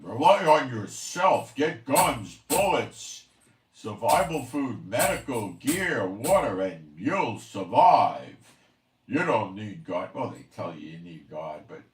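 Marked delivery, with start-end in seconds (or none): 0.0-10.1 loud, slow mocking pitch of the survival sellers; hammed-up prepper sales pitch, loud and drawn out. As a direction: shout their slogan back at them in mockery. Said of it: "they're selling the people on".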